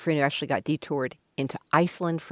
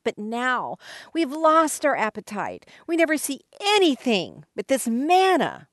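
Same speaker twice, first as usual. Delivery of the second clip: slow, high complaining whine; whiny voice; 7 semitones higher, slower than usual. Acted. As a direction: moan about the food. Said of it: "You have to read it with a whiny voice".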